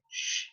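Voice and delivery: "sh" as a soft voiceless hush; isolated SH sound. Shown as a demonstration. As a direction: let "sh" hiss on its own. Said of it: "The other sound".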